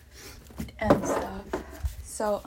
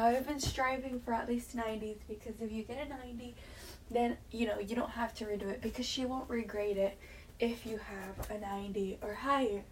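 usual speaking voice

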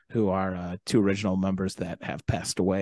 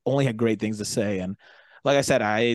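ordinary talk

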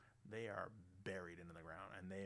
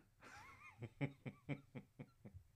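high, long chuckle; high giggling run that tapers off; laugh; 6 semitones higher than usual. Real, not acted. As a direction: crack up at your own punchline. Laughs after own punchline.

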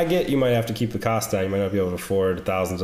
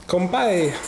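light mock Japanese accent toast; 9 semitones higher than usual. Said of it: "'Cause I did a Japanese accent".

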